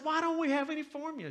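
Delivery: high, strained-pitch questioning; frustrated husband. Retold or imitated. Husband to wife about baby formula, high exasperated question. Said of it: "And I'm frustrated".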